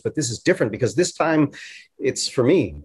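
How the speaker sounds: normal talking voice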